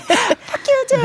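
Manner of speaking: very high, playful returned insult; mock cheery comeback. New Yorker returning a morning greeting, mock cheery cursing. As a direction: return the curse like a friendly hello.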